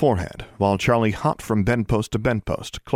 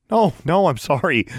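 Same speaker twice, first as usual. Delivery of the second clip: higher, hurried apology; quick apology; 7 semitones higher, 4 dB louder than usual. A narrator's reading. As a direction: rush to apologize before the caller leaves. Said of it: "she apologized quickly".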